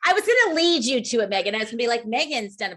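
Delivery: loud, high-pitched burst; real excited outburst, easing slightly; excited interjection. Real, not acted. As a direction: talk over them eagerly, voice jumping up. Excitedly talking over others to Megan.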